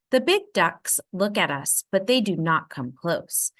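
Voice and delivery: ordinary delivery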